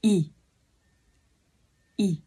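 y, not ư; Korean ư read y. Shown as a demonstration. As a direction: say y here. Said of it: "mình không đọc là ư nữa mà mình đọc là".

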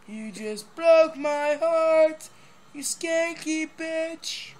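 mock heartbreak.

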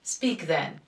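father angrily ordering his daughter to speak; angry command. A narrator's reading. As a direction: snap it at the formalist. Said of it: "casting an angry and disdainful look".

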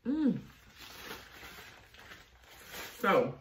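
talking between bites; chewing. Real, not acted. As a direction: mouth full of food. Seen 2: Forkful of mac and cheese goes in at 1.1; chews after. Not rib tips.

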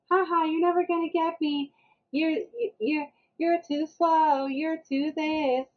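high, drawn-out mimicked taunt; teasing child. Son repeating YouTube lines at park, teasing.

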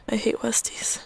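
whispered aside; breathy whispered dislike. Real, not acted.

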